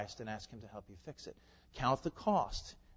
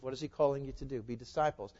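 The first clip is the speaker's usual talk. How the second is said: ordinary talk, nothing marked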